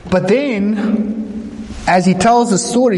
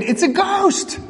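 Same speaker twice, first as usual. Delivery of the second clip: high frightened gasp of a line; terrified cry; 12 semitones higher, 3 dB quieter, slower than usual. A narrator's reading. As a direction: squeal it out in terror. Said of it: "They were terrified and said".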